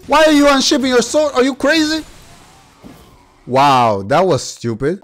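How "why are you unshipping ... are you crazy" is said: high, fast disbelieving yell; panicked shout at the enemy; shocked outrage. Real, not acted. Enemy suddenly draws sword.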